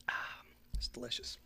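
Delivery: breathy whispered aside; hushed tasting whisper. Real, not acted. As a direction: whisper it, savouring the taste. Tasting the drink.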